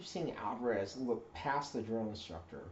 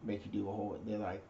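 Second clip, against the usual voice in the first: normal talking voice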